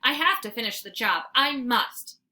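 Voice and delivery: ordinary delivery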